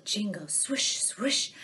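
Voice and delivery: plain reading voice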